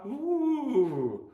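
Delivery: high ghostly woo; spooky woo. Pretend. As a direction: wave it like a ghost. Spooking viewers with a knife.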